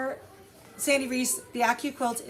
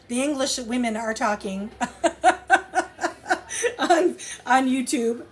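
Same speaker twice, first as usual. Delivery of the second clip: laughing; 4 dB louder than usual. Real, not acted. Amused at English viewers talking.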